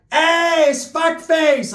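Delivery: high, loud, slow greeting yell; aunt shouting a greeting across the party; loud call out. Retold or imitated. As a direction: holler across the party at him. Tia calls out loudly.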